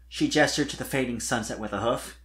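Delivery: plain reading voice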